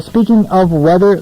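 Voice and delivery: normal talking voice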